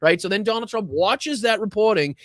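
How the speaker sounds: usual speaking voice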